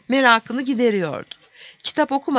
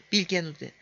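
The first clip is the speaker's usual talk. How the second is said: ordinary delivery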